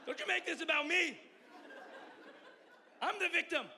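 high, loud protesting self-pity through laughter; hammed-up wounded victim, shouting and laughing; mock indignant. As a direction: defend yourself like a put-upon husband, loud and laughing. Retold argument with wife.

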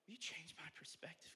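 soft, breath-only plea; whispered prayer. Real, not acted. Preacher asking God to change his view, whispered prayer.

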